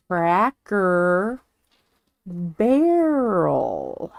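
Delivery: Cracker, pause, Barrel, each drawn out; slow careful pronunciation. Shown as a demonstration. Showing how they must say the restaurant, slowly. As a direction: separate the two words and stretch them. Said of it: "I can't say it fast".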